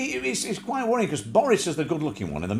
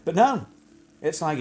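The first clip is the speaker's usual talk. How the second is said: normal talking voice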